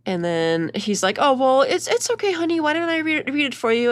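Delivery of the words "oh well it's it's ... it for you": high, sugary mock-husband voice comforting his wife; mock soothing husband. Husband soothing his crying wife on camera, hammed-up sweetness. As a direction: coo it like a fake sweet husband.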